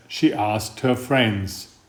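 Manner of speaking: ordinary delivery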